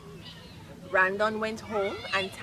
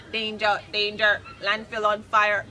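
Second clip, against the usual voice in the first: robot alarm voice; 8 dB louder than usual. Acted.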